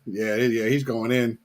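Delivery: usual speaking voice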